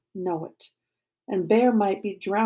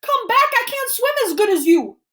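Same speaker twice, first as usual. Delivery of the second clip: high, loud panicked plea; desperate scream; 13 semitones higher, 7 dB louder than usual. A narrator's reading. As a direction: shout for a swimmer to come back. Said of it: "I scream, gasping, treading water".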